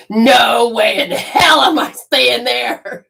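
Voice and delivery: slow, loud and high refusal; defiant shout. Retold or imitated. She refuses the creepy hotel in a loud slow shout. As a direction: yell it out, no way you're staying there. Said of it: "I said".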